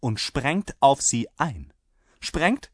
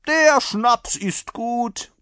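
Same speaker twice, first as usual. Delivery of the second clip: loud, high, drawn-out approval; relishing rider; 14 semitones higher, 9 dB louder, much slower than usual. A narrator's reading. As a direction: savour it slowly at full voice. (genüsslich gedehnt, laut und hoch)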